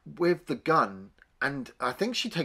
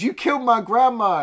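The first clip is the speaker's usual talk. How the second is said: accusing cry; 9 semitones higher, 7 dB louder than usual. Retold or imitated. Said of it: "and she goes".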